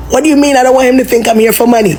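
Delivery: ordinary talk, nothing marked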